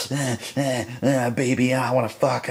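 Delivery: high, grunting frenzy; acted demented villain. Frank in a disturbing frenzy over the woman, mock deranged. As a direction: grunt and whine like an unhinged creep.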